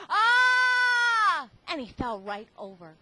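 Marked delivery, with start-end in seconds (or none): 0.1-1.5 high loud terrified shriek; yelled ah. Acted. Father gets scared by Lance, high loud shriek. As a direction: shriek as if startled out of your skin. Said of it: "His father yelled".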